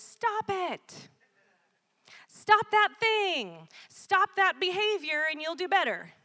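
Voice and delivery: mock scolding, pitched high and loud, slow on "Stop that thing"; preachy scolding. Preacher mimicking people scolding an addict, mock stern. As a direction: lecture them like a nagging scold. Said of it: "Typically what we say to people is".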